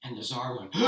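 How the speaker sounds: plain reading voice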